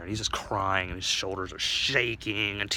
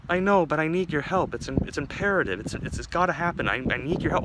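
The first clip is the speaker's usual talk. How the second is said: higher, stumbling desperate plea; urgent pleading; 8 semitones higher, 4 dB louder than usual. Acted. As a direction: beg for help, tripping over words. Dick pleads urgently at doctor's door.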